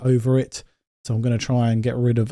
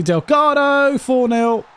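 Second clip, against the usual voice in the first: much higher, louder excited goal call; 11 semitones higher, 3 dB louder, slower than usual. Real, not acted. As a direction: shout the scorer like a commentator. Calling a goal.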